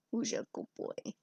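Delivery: a soft, breathy whisper to the pet. Real, not acted. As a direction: whisper it to the cat. Talking to cat, whispered.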